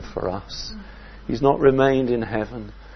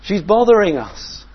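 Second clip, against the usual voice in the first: higher and louder, irritated grumble; fed-up followers wanting her gone; complaining disciples; 6 semitones higher, 6 dB louder than usual. Retold or imitated. Disciples complaining about the woman.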